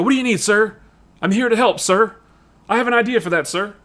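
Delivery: put-on eager-to-please young agent, high pitched; fawning keenness to help the boss; imitating a kiss-ass subordinate; Jasper Sitwell. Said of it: "he's kind of a kiss ass".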